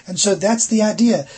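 ordinary talk

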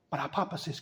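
ordinary delivery

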